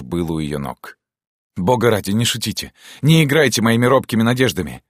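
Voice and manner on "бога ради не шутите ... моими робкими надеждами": higher, urgent pleading; imploring. Acted. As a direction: beg not to be toyed with. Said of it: "молил он". (умоляюще, взволнованно)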